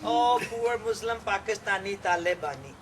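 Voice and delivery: ordinary delivery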